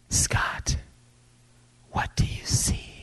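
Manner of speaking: soft unvoiced whisper; whispered voice of God. God whispering a question to Scott, in a soft whisper. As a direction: hush it like a voice within. God speaking softly to the preacher.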